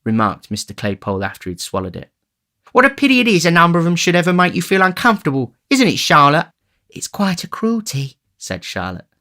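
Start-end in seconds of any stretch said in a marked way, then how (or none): 2.7-6.5 high, loud, quick oafish voice; high-pitched tipsy character voice; tipsy greedy youth. A narrator's reading. Tipsy Noah chats over oysters. 6.9-8.2 put-on high-pitched sympathetic young woman; doting sweetheart. A narrator's reading. Charlotte agrees with Noah.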